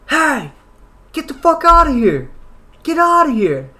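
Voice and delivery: imitating an angry roommate yelling high and loud; retold scream. Roommate finds strangers on his couch, screams at them. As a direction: belt it out like he's throwing strangers out. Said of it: "just screaming at us".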